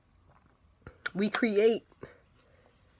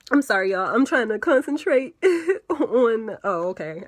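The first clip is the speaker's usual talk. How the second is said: giggling through the words; can't stop laughing while talking; 4 semitones higher, 5 dB louder than usual. Real, not acted. Laughs while trying to concentrate.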